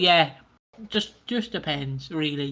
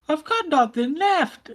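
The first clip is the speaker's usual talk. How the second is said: very high, drawn-out melodramatic cry; mock dramatic wail; 12 semitones higher, slower than usual. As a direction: wail it like an overblown soap line. Acted catchphrase, then sold as T-shirt slogan.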